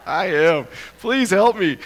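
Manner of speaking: high, loud plea for help; pleading. Retold or imitated. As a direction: beg for help, half joking. Lost, begging security guard.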